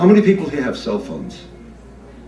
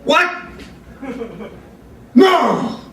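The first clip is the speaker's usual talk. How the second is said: furious phone rant; 14 semitones higher than usual. Retold or imitated. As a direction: rage down the phone at an employee. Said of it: "he's going".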